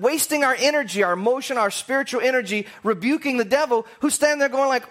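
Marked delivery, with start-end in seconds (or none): none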